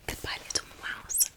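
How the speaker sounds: usual speaking voice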